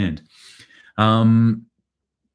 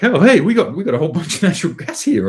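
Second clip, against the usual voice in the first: bad Ukrainian accent, much higher, louder and fast; eager Ukraine, played in a hammed-up Ukrainian accent, pitched way up; 10 semitones higher, 5 dB louder, faster than usual. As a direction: Ukraine bragging, in a cheesy high Ukrainian accent. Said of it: "I apologize about my shitty accents".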